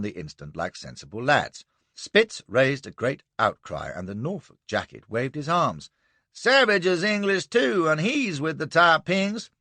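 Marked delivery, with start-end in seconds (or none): 6.3-9.5 high, somewhat louder bellow. Acted. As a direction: bellow the accusation. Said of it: "he bellowed".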